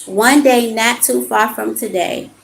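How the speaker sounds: measured, emphatic reading of card text. Acted. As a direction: read the card like a gentle sermon. Reads oracle card aloud.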